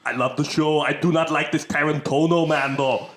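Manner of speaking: Austrian-accented action star impression; mock Austrian accent; Arnold Schwarzenegger. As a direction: play a disapproving Austrian strongman. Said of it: "can't imagine Arnold Schwarzenegger doing".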